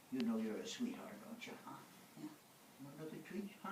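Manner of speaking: soft, trailing into a whisper; whispered cooing to a dog. Real, not acted. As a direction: coo to her, then whisper.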